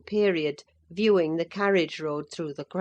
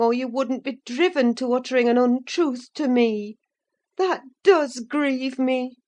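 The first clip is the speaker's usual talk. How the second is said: hurt housekeeper pleading; sorrowful; 7 semitones higher, 4 dB louder than usual. A narrator's reading. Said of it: "I cried sorrowfully".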